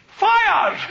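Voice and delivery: joking shout of fire; laughing mock shout. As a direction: bark the order through a laugh. Irish prisoner shouts the order.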